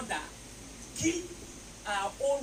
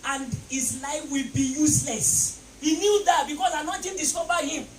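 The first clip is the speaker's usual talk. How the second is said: shouted sermon, rising with the crowd; preaching at full force; 8 semitones higher, 16 dB louder than usual. Acted. Preacher rousing the congregation, shouting. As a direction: preach it loud over the music.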